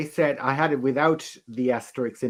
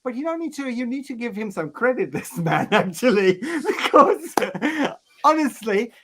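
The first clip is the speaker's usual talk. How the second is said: higher, laughing, mock defiant defence; retold provocation; 9 semitones higher, 3 dB louder than usual. Retelling to the hosts what he told the crowd, higher and laughing. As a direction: push the cheeky point, laughing as you go. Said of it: "I said".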